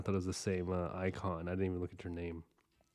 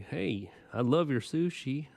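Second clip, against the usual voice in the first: higher, slower mock voice of a clueless person; mocking other people; 7 semitones higher, 4 dB louder, slower than usual. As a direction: parrot them in a higher, drawn-out voice. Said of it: "They're like".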